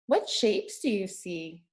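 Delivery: ordinary delivery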